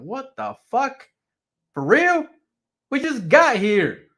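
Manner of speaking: high, stunned what the fuck; shocked disbelief. Retold or imitated. Shocked reaction to wife leaving.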